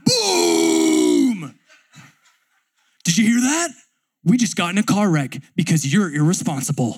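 yelling coach. Retold or imitated. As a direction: scold him like a furious coach. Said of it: "And he's like".

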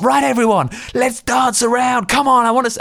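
put-on whiny over-the-top kid rallying the room; imitating the actor. Actor getting everyone to dance, very high whiny kid voice. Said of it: "and she was like".

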